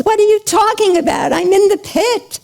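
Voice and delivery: high, fast, exasperated retort; acted incredulous outburst, pitched high; indignant protest. A narrator's reading. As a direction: protest in disbelief at an absurd demand. Trapped man protests lawyer's demand.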